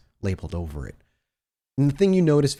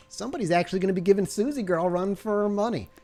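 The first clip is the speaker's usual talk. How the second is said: a higher, fast, smug guard teasing the new nurse; cocky flirting guard; 5 semitones higher than usual. Acted. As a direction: play the sloppy 1950s guard showing off. GM holds the guard's voice.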